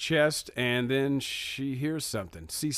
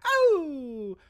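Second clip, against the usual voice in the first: high startled squeal; girly gasp; 13 semitones higher, 3 dB louder than usual. Retold or imitated. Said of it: "She's like".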